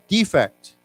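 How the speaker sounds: defect stressed on the first syllable, DE-fect; noun stress. Shown as a demonstration. Teacher leading the class in the noun form, first syllable stressed. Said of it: "let's say it the way it's written here, like a flaw".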